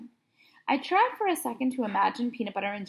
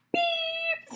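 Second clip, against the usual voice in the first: high flat electronic beep; be the lie detector going off; machine beep. A narrator's reading. Lie detector catching the boy's lie, high flat beep.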